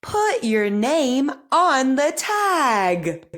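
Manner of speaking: slow, careful speech-therapy reading; crisp model sentences for repeating; over enunciating. Shown as a demonstration. Therapist reads practice sentences.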